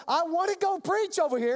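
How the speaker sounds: high-pitched, insistent wish; eager insistence. Retold or imitated. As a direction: plead to be allowed to go. Acting out Paul's eager protest.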